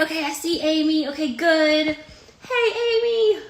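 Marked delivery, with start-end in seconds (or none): 1.9-3.4 high, sustained sing-song greeting; calling out. Real, not acted. Host greets guest joining the live, calling out high. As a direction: call out high, drawing the name out.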